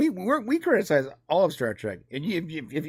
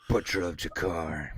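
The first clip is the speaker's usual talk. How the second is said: much lower, softer mock-ominous echo; ominous villain voice; 11 semitones lower, 8 dB quieter than usual. Co-host echoing a villain's title, mock-ominous deep voice. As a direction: drop deep to intone a dreaded title.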